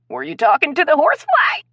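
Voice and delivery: holding back laughter in a high voice; squeaky high stifled-laugh question. Acted. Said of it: "in a pitch higher than normal".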